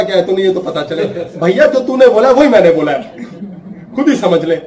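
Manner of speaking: loud, sharp back-and-forth; acted quarrel. Brother and sister shouting in a fight. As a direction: snap at each other like siblings fighting.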